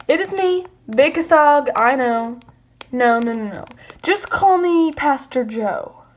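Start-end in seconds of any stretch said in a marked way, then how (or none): none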